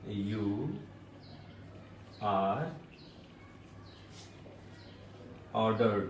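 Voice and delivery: words spaced out one by one; spaced dictation for the class; slow dictation. Shown as a demonstration. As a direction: dictate it word by word, softly.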